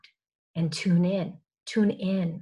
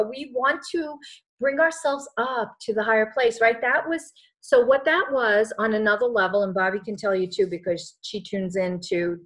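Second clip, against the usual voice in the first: ordinary delivery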